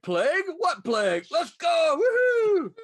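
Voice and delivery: hammed-up reckless Florida cheer; mock carefree shout. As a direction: brush off the plague with a party yell. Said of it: "No, we're like".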